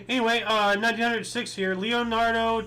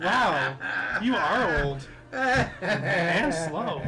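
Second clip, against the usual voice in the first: laughing tease; much slower than usual. Real, not acted. Teasing father while laughing.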